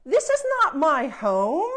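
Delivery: loud, spoiled princess refusing the house; haughty princess. Acted. Spoiled princess to the fairy, loud and haughty. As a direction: turn your nose up and declare it loudly.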